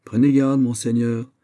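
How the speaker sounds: frowning, firm warning; stern warning. A narrator's reading. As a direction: caution him gravely. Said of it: "en fronçant le sourcil". (avertissement sévère)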